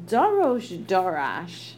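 Dorosh Dorash stretched out slowly with weight on each word; slow and weighty Dorosh Dorash; emphatic repetition. Shown as a demonstration. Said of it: "is exceedingly emphatic".

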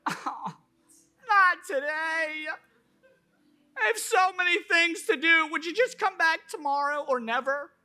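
hammed-up sobbing plea to be left alone; mock whining.

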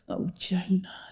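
soft, breathed-out reproach; sighing. A narrator's reading. God answers the complaining Jonah, sighing. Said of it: "sighed God".

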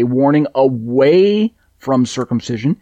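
"away" drawn out with rising pitch, then a pause; stress on away. Real, not acted. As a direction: lean hard on "away", stretching it upward. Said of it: "emphasis on the word away".

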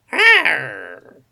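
loud mock growl; cat growl. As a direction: snarl it back like a cat. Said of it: "Modigliana growls back".

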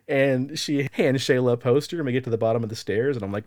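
usual speaking voice

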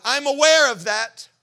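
God answering with a tremble; trembling voice of God. Retold or imitated. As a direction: let God's voice tremble out loud. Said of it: "God's voice begin to tremble".

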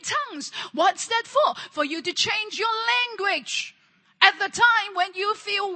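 loud, pleading sermon cry; fervent preaching. Acted. Preacher urging the congregation to change their words, loud. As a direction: preach it hard, repeating the call.

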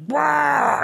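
high-pitched imitated roar; mock angry yell. Angry voice bursting from the baby monitor, imitated yell. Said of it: "like, an angry voice. You're like".

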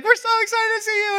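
high, fast, gushing grandparent voice. Retold or imitated. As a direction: squeal it like thrilled grandparents. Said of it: "and they're like".

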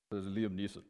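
low, quiet movie-star intro; a lightly voiced Liam Neeson; low and soft, announcing the impression. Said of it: "I'm gonna do my best Liam Neeson voice".

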